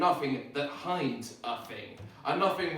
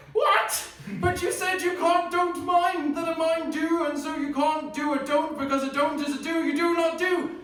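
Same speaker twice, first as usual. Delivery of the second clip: incredulous disbelief; 11 semitones higher, 3 dB louder than usual. Acted. Incredulous recall.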